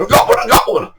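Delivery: high, breaking yelp of excitement; excited cry. Retold or imitated. Victor to his mate on hooking a fish, excited yelp. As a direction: imitate an angler thrilled by a bite.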